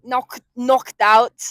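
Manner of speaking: loud, drawn-out Scouse "knocked out". Shown as a demonstration. Practising the k with friend, Scouse accent. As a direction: stretch "knocked out" with scraped Scouse k. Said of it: "it's like a k".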